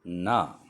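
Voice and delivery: teacher models ना for the learners; model sound ना. Shown as a demonstration. Teacher repeating the letter ना for students, clear and firm. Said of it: "दोहराता हूँ".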